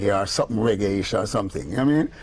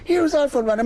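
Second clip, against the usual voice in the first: high, proud reveal; excited lady. Retold or imitated. Lady shows Clive the picture, high and excited. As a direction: announce it up high like a prize.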